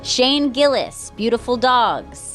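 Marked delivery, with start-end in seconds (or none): none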